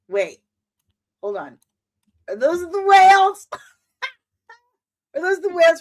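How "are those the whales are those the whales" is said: high, loud thrilled cry; excited shriek. Real, not acted. Fan spotting the whales from Star Trek Four, shrieking. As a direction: yelp it out in sudden delight.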